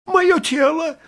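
very high, loud, frivolous falsetto; squeaky, eager woman's voice; Брике. A narrator's reading. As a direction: exclaim as if about a new dress. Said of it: "сказала Брике таким тоном, как будто вопрос шёл о новом платье".

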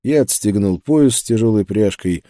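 ordinary delivery

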